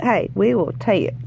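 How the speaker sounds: ordinary talk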